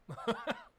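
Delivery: nervous giggle. Real, not acted. Embarrassed at the loop mistake.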